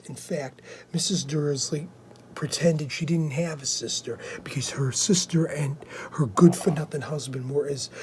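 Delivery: quietly reading a story aloud; hushed reading. Acted. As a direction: read on softly so as not to disturb.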